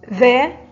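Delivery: loud, clear announcement of ذا; loud teacher naming the letter ذا; letter announced. Shown as a demonstration. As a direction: call out ذا to the class. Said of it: "حرف الذال محرك بحركة الفتح".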